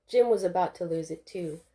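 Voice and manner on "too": ordinary delivery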